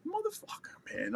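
high, soft disbelieving curse; can't believe they left us; disgusted. Retold or imitated. Angry at being left as bait.

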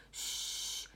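soft, long hush; pretend shush. Teacher playing someone asking for silence, shushing. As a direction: shush a noisy person. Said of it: "pedindo pra alguém fazer silêncio".

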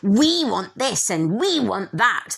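slow, whiny mocking voice; mock entitled narcissist. Mocking entitled narcissists, slow and whiny. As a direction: drag it out like a spoiled brat. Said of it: "They're so".

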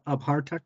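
Abhartak with a clear bh and a hard k ending; Abhartak, given as the answer; Irish pronunciation. Shown as a demonstration. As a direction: say Abhartak plainly and confidently. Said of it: "I would say".

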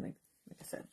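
whispered aside. Real, not acted. Unvoiced aside.

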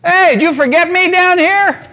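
high, loud comic yell as a rebelling stomach; hammed-up shout of protest; screaming stomach. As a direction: holler up indignantly like a neglected belly. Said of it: "stomach is gonna start screaming".

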